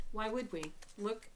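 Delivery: usual speaking voice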